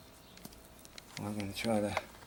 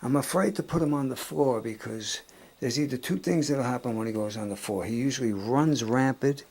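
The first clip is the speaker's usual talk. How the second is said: upset; 3 semitones higher, 9 dB louder than usual. Real, not acted. Emotional after dog's seizure.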